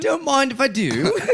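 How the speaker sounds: mock British accent. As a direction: accept like a hammed-up Brit. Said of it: "a Hugh Grant movie".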